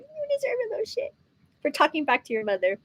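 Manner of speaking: high, hushed mock scolding mother; playfully voicing a mother scolding her kid; mock scolding mom. Scolding a child, voiced mother.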